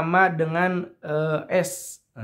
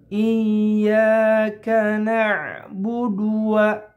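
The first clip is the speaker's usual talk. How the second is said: slow melodic chanting of Iyyaka na'budu wa; Quran recitation; 3 semitones higher, 3 dB louder, much slower than usual. Acted. Teacher reciting the verse for students, slow chant. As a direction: chant it like tajwid recitation, drawn out.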